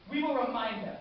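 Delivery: loud, high-pitched vow; rallying refrain. Acted. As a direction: declare it loudly to the troops. Rallying refrain in war speech.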